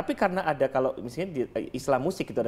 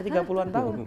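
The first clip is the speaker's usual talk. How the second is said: laughing; 4 semitones higher than usual. Real, not acted.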